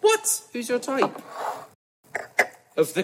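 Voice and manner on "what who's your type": very high, loud disbelieving what; outraged squeaky what; shocked. Real, not acted. Shocked at Liz's answer.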